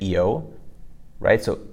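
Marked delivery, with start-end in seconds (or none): 0.0-0.5 "E-yo" as double E then yo; pinyin IU. Shown as a demonstration. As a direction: say "E-yo" joined. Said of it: "smush that together".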